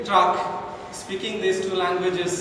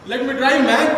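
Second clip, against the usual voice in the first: mock George; 6 semitones higher, 8 dB louder than usual. George's line voiced.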